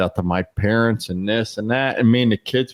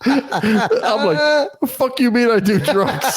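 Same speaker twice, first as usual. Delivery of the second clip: high, laughing through the words; can barely get it out for laughing; laughing disbelief; much slower than usual. Real, not acted. Retelling the kid's drug accusation, laughing in high disbelief.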